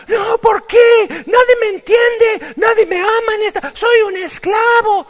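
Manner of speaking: very high, loud wailing shout; a teenager wailing that nobody loves him; hammed-up teen tantrum. Teen dramatic tantrum over bed.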